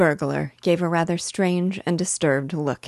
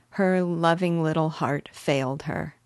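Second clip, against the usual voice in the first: plain reading voice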